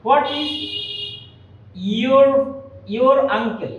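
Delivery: broken into pieces, your runs into uncle with an r; pause, then join your uncle through r; linking R pronounced. Shown as a demonstration. Teacher modelling R before a vowel, r sounded in your uncle. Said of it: "vowel থাকলে এটার উচ্চারণ করতে হয়".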